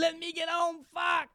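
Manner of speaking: high, loud American yell; American accent, shouting. Pretend. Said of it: "je peux le dire en américain".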